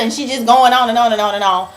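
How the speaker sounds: ordinary delivery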